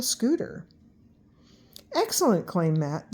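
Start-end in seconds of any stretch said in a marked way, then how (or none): 1.9-2.5 high delighted exclamation; excited. A narrator's reading. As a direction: burst out with delight. Matt exclaims.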